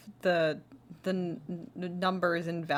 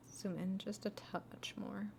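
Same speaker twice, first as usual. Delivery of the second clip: whisper; 10 dB quieter than usual. Real, not acted. Quietly editing to herself.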